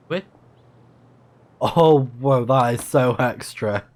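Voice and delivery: ordinary delivery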